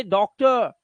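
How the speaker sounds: डॉक्टर ending in an open uh; silent r. Shown as a demonstration. Teacher shows class डॉक्टर, ending in uh. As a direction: let डॉक्टर fall away on uh, no r. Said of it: "वो अ की आवाज होती है".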